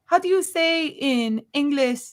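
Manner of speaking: put-on high, slow Spanish-accented question; imitating a Spanish accent. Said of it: "she turns and she goes".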